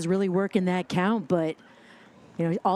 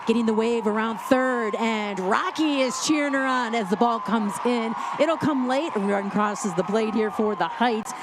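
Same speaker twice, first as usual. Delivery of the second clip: excited play-by-play; 3 semitones higher than usual. Real, not acted. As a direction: call the run home with rising excitement. Calling a run scoring.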